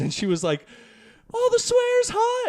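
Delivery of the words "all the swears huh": much higher put-on grandma scolding. Grandma catching grandson watching an R movie, high scold. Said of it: "the tone of voice".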